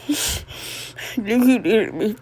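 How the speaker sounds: soft laughing. Acted. Son laughing softly.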